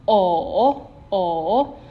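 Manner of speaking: "o" dipping down and coming back up; valley tone. Shown as a demonstration. Teacher modelling the V tone, valley tone. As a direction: let "o" sink, then rise. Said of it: "for the V tone".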